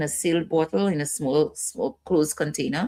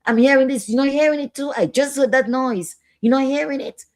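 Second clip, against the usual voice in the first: high, insistent voice of someone hearing things; agitated patient; 8 semitones higher, 4 dB louder than usual. Acted. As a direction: play a distressed person with delusions. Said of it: "making them get aggressive and, and say".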